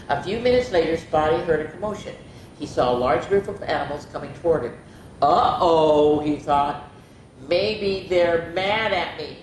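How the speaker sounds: lively picture-book reading; expressive bedtime-story narrator; story read-aloud. A narrator's reading. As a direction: read it to children, voicing each animal lightly.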